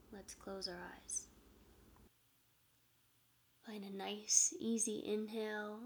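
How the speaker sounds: soft spa whisper. Acted. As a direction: ease them into calm breathing. Leads a meditation.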